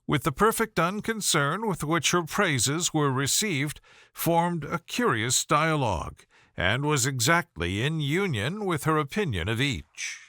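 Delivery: usual speaking voice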